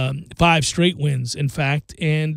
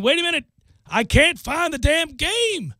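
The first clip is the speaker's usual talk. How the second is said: frantic viewer; 10 semitones higher than usual. Retold or imitated. Host voicing a fan who can't find the game, mock-frantic. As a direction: play a flustered fan hunting the channel. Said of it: "when you are frantically flipping about".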